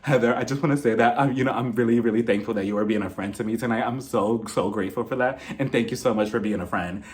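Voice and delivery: low, subdued mock sincerity; imitated earnest thanks. Imitating an earnest thank-you to Heather.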